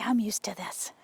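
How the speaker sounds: whispered horse thoughts; voicing the horse. Trainer voicing her horse's thoughts in a whisper. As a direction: breathe it out as the horse's calm inner voice. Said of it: "He's like".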